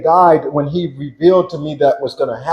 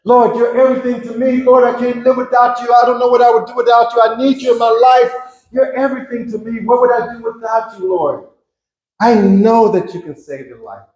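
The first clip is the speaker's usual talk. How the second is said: high, earnest prayer to the Lord; devoted prayer; 8 semitones higher, faster than usual. Shown as a demonstration. Man showing the prayer he should have prayed, high and devout. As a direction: pour out devotion to God. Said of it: "I should have said".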